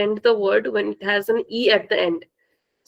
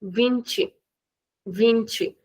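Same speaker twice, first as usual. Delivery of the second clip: vinte ending in tchi, softer; Brazilian Portuguese; 4 dB quieter than usual. Shown as a demonstration. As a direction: end vinte on a soft tchi. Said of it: "In Brazilian".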